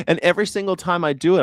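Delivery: ordinary talk, nothing marked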